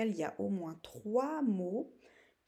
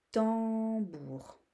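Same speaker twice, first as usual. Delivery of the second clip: "tambour" higher, with a long held "tam"; drawn-out syllables; 4 semitones higher, 4 dB louder than usual. Shown as a demonstration. Teacher naming the last picture, drawn-out syllables. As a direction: stretch "tam", then "bour". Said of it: "au début ou à la fin".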